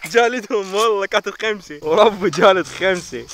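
loud, slow triumphant bragging; excited. Real, not acted. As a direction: drag it out in triumph. Boasting five kills.

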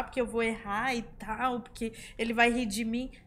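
ordinary delivery

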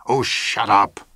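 loud, drawn-out outburst; almost shouting. A narrator's reading. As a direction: snap loudly, just short of a full shout. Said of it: "McComber almost shouted".

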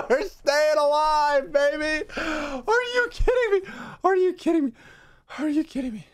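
real overjoyed hollering; euphoric shout. Real, not acted. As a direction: yell it out in disbelief. Euphoric after survival.

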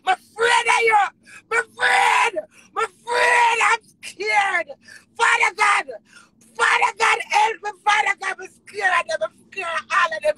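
high, loud tearful wail; real frightened crying. Real, not acted. Frightened performer pleading to Father God, tearful. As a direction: cry out in fear, easing slightly at the end.

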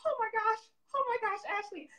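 high breathless gushing; starstruck. Retold or imitated. Designer gushing at meeting Ashley, high and excited. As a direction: lose it over meeting a star. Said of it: "I'm like".

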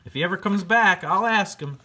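chirpy bat Charlie. Acted. Charlie the bat answers Fred.

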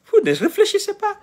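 high, laughing in disbelief; laughing at how foolish they are; exasperated laugh. Real, not acted.

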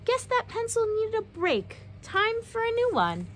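sunny upbeat exclamation; cheerful exclaim. Acted. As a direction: chirp it out like nothing can go wrong. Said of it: "she would exclaim".

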